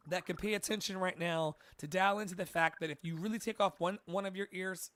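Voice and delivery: ordinary talk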